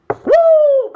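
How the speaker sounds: high, loud "woo" held long; excited whoop. Real, not acted. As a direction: let out a high, ringing whoop. Elated outburst after urging nationalization.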